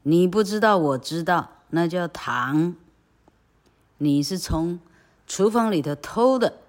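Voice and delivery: loud accusation rising at the end; 大声指责; accusing. Acted. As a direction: nail the thief. Aunt accusing.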